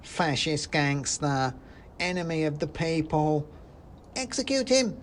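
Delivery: slow high denunciation rising to a shriek; a tiny zealot demanding blood; angry mouse shout. Acted. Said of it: "shouted a voice".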